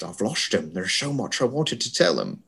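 soft, fading attempt at a Scottish Connery accent; Sean Connery accent. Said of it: "I kinda lost it there".

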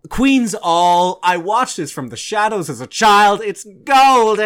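excited build to a high, ringing shout; gleeful hype. Pretend. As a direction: belt out the big title reveal. Hyping next episode's film, building climax.

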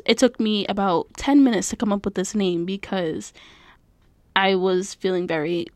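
ordinary talk, nothing marked

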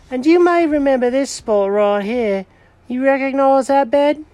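high, eager cat character voice; proud cat showing Norman the bed, high and eager; Jenkins the cat voice. Acted. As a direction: play the cat showing off his old find.

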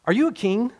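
high, sharp question as Pilate. Retold or imitated. Pilate asking Jesus if he is a king, high and sharp. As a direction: challenge him as the governor.

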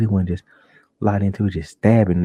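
usual speaking voice

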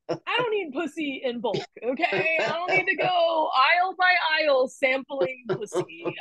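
high-pitched mock indignation; comic protest. Guest riffing on the Costco membership joke, comically indignant. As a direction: play up the objection as a stand-up bit. Performing own stand-up joke.

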